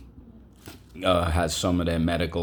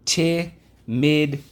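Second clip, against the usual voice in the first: high, slow stretched timidly; drawn-out timidly; 9 semitones higher, 4 dB louder, much slower than usual. Shown as a demonstration. Teacher modelling the word for the class, stretched out. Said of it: "Let's go".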